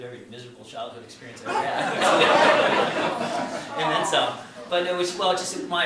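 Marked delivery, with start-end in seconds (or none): 2.0-3.8 loud open laugh at his own line; big real laugh; hearty laugh. Real, not acted. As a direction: let the laugh burst out. Audience laughs at his remark.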